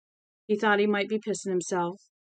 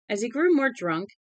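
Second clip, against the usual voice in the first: usual speaking voice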